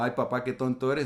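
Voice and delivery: light, quick teasing "tonto" like a joking daughter; playful teasing. Retold or imitated. Daughter jokes with her dad, playful quick teasing. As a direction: tease your dad fondly, fast. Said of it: "mi hija me lo dice en tono de broma".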